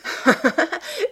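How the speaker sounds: high, merry peal of laughter; girlish acted laugh. A narrator's reading. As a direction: burst out laughing, high and light. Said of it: "Elle se mit à rire".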